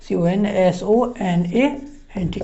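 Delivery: letters spelt out one by one, slowly; slow spelling. Shown as a demonstration. Teacher spelling consonant for students, slow and deliberate. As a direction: spell each letter so they can write. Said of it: "spelling out letter by letter".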